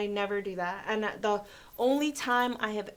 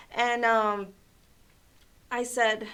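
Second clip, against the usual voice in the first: plain reading voice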